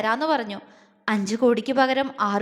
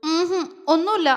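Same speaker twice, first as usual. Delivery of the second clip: tearful denial; 4 semitones higher than usual. A narrator's reading. Crying girl sniffling.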